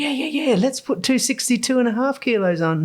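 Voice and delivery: bright eager yes. Retold or imitated. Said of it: "I just said".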